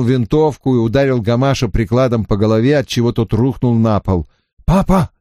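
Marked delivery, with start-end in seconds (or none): 4.6-5.1 high, crying out; shouted. A narrator's reading. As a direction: call out in alarm. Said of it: "прокричал Даниэль".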